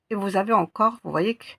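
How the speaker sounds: normal talking voice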